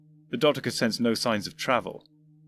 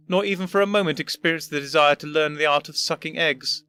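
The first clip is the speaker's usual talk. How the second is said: higher, lofty lecturing voice; unimpressed old gentleman; 5 semitones higher, 4 dB louder than usual. Acted. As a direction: lecture him with disdain. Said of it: "considerably unimpressed".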